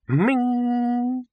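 high ringing ding of a vase; ringing pot. A narrator's reading. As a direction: sing it like a struck vase. The pot rings.

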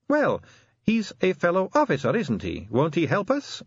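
lightly voiced constable, appealing for help; Sally, hopeful young constable. A narrator's reading.

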